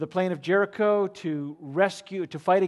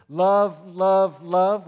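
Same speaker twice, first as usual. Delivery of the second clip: loud proclaiming; 3 semitones higher, 9 dB louder, much slower than usual. Shown as a demonstration. Hypothetical repetition, loud.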